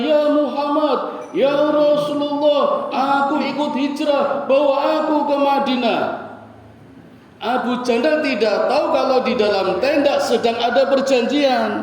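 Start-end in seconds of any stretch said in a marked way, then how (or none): 0.0-6.0 retold crying out. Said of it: "sambil berteriak-teriak".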